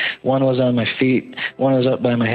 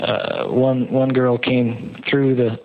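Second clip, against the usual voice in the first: normal talking voice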